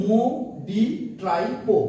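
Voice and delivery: মো ডি ট্রাইপো slowly, syllable by syllable; drawn-out, separated beats on মো ডি ট্রাইপো; memory code demo. Shown as a demonstration. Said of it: "তোমরা মাথায় রাখবে". (মো ডি ট্রাইপো ধীরে, ভেঙে ভেঙে)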